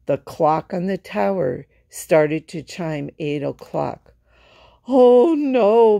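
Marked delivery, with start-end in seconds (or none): 4.9-6.0 loud high cry of dismay; shouting. A narrator's reading. Berlioz hearing the clock chime eight, shouting in alarm. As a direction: shout it out in panic. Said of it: "Berlioz shouted".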